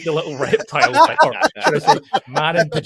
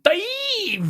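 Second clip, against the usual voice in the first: high drawn-out mock battle cry; over-enunciate it as a long, hammy scream; Brian Blessed scream. Fan demonstrating how an actor enunciates, imitated high scream. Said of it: "there's such enunciation to every".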